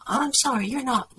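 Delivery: put-on high-pitched young man's apology; apologetic son. A narrator's reading. Son apologizes to the waiter, high-pitched.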